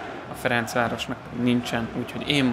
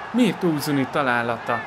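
ordinary talk, nothing marked

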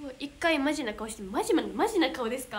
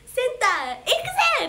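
louder, shout "センター、行くぜ！" from the belly; sudden battle cry; 10 semitones higher, 9 dB louder than usual. Shown as a demonstration. Idol showing fans her concert call-out, a sudden loud shout. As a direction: fire yourself up and yell it out. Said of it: "急な大声すみません".

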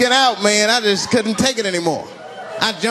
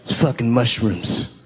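slow, low, drowsy voice; low party guy; 8 semitones lower, 3 dB quieter, slower than usual. Retold or imitated. Guy at a party handing him mushrooms, low and slow. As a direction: drag it out low and lazy. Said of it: "He's like".

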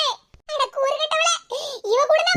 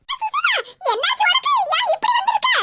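much higher, startled neighbor calling out; shocked neighbor woman; 7 semitones higher than usual. Acted. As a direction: gasp at your injured friend in a high voice.

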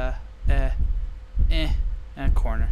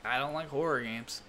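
much softer, deflated; real, much softer; drained mutter; 2 semitones lower, 12 dB quieter than usual. Real, not acted. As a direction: mumble it, worn out from the fright. Drained after the scare.

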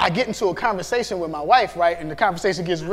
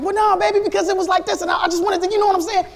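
eager protest; 10 semitones higher, faster than usual. Retold or imitated. Husband itching to argue back with his wife, high and eager. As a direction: rush to explain yourself, voice jumping up. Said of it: "I wanna be like".

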